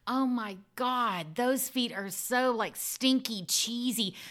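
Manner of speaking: high, slow, hammed-up "so stinky, cheesy", squealed "Pew-wee"; a sex worker playing up disgust for a foot client; mock disgusted client talk. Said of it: "trying not to laugh".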